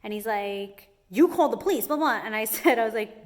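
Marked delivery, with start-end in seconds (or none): 1.1-2.2 snide mocking higher and louder; mock nasty retort. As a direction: imitate a rude inspector sneering. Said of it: "was being so nasty to me".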